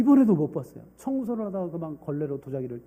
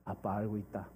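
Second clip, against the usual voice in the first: gentle father; 8 semitones lower, 12 dB quieter than usual. Acted.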